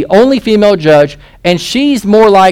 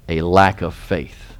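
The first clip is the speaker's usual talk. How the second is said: ordinary talk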